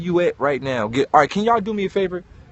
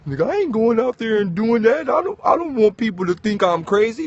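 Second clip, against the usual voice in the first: mocking depressed listener; 5 semitones higher than usual. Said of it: "Look at y'all".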